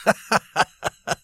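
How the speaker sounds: put-on young man's scoffing laugh; loud laugh. A narrator's reading. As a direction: laugh out at the prince. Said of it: "laughed loudly".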